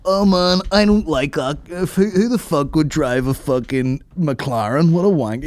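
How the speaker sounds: high sneering voice; mock hater.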